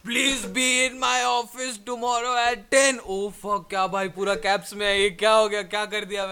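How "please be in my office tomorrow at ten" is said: all-caps message. Acted.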